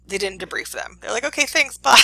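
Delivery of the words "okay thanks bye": high, loud, chirpy send-off; mock-breezy dismissal. Teacher mimics experimenters sending subject home, high breezy farewell. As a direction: toss off a cheery goodbye.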